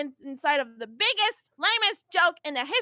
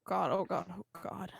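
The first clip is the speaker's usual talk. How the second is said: low, much softer, muttered; muttered dismay; 8 semitones lower, 10 dB quieter than usual. Real, not acted. Vriska's actor, dismayed at the broadcast, muttering under breath. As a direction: groan it under your breath. Out-of-character groan.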